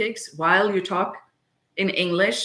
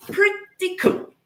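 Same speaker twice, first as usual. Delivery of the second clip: slow, much higher cutesy pretty cool; high playful voice; 12 semitones higher than usual. Real, not acted. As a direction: stretch it out up high.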